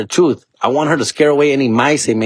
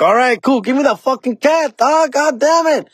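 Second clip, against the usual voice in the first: higher, pushy demand for the cat; mock-excited customer demanding his cat; hyped demand. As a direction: grab it now, all worked up.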